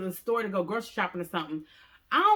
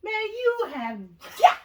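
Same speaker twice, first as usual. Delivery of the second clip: high then loud exasperation; he sees the flooded bathroom; exasperated boyfriend; 7 semitones higher, 8 dB louder than usual. Retold or imitated. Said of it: "He was like".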